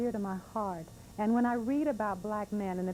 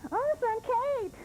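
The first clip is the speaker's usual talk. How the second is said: put-on little girl; 4 dB louder, much slower than usual. Little girl begging for cake.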